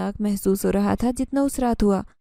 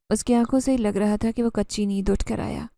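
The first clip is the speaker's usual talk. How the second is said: usual speaking voice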